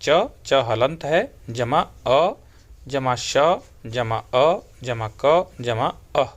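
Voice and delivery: slow letter-by-letter teacher spelling; patient classroom spelling of च plus अ; spelling out slowly. Shown as a demonstration. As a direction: build the word one sound at a time. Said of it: "उदाहरण दिया आपको".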